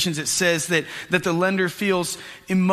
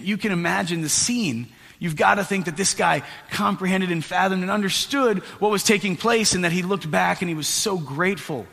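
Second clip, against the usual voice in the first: usual speaking voice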